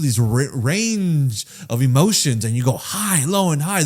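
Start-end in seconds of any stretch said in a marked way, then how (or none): none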